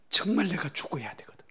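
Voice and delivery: hushed earnest whisper. Real, not acted. Pastor stresses dying to self, earnest whisper. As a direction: drop the voice to make it sink in.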